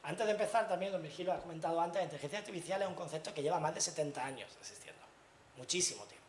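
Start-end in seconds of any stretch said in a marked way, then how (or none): none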